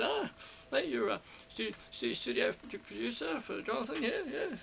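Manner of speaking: high-pitched big radio voice impression; Bruce McKinley. Radio man greeting the new studio producer, big high radio voice. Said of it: "he had a big radio voice".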